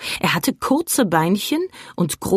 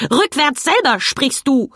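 scolding; 7 semitones higher than usual. A narrator's reading. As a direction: tell the boy off in outrage. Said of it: "schimpfte der Plappergei".

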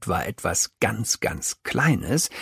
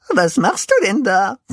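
verdattert; 10 semitones higher, 6 dB louder than usual. A narrator's reading. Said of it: "fragt Pinsel verdattert".

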